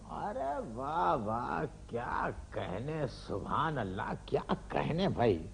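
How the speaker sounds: soft, slow, drawn-out praise; rapt admiration. Acted. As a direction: savour each word of applause like a mushaira listener. Father applauds son's verse.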